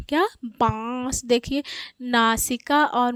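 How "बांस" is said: बांस with long nasal आ before स; nasalised vowel demo. Shown as a demonstration. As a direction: stretch बांस through mouth and nose. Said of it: "इसको भी उच्चारित करेंगे".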